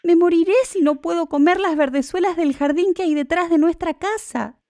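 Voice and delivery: high-pitched, pleading lament; pining wife. A narrator's reading. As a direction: plead as if wasting away. Pining wife voiced in story.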